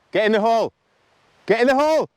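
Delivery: will the putt into the hole; excited yelling. Real, not acted. Urging putt toward hole.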